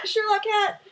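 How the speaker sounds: calling out to the cat as he causes trouble, high and laughing; excited laughing call. Real, not acted. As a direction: laugh and call the cat's name, delighted and a little alarmed. The cat knocks something over.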